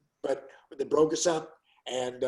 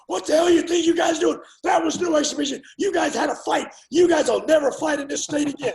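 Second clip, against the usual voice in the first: much higher, much louder furious yelling; yelling and screaming; 12 semitones higher, 6 dB louder than usual. Retold or imitated. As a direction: chew them out like an enraged boxing official. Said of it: "starts yelling and screaming".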